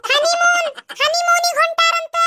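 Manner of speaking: very high, drawn-out whine; wailing. Acted. Sister announcing the others' honeymoon, wailing. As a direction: stretch the news into a high wail.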